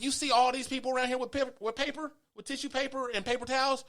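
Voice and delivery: high-pitched acted protest; re-enacted exasperated challenge; retold indignant argument.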